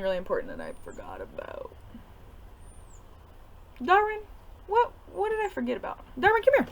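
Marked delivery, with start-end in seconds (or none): 3.8-6.7 sing-song high call to the dog, pitch way up. Real, not acted. Teacher calling her dog Darwin over, high sing-song. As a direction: coax the pup over in a high voice.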